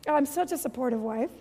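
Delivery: normal talking voice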